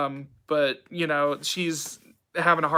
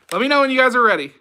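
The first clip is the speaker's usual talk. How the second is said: fast, higher, louder tease; excited teasing; 7 semitones higher, 7 dB louder, much faster than usual. Real, not acted. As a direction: rush it out with glee. Teasing the audience.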